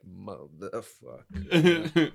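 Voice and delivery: slow, drawn-out seething curse; muttered curse. Retold or imitated. Officer fed up with an interrupting dude, slow seething curse. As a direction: let it out slowly under your breath at him. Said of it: "and you're like".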